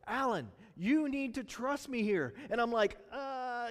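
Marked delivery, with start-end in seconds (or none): 0.1-2.3 God speaking. Retold or imitated. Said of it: "that's what God says to us".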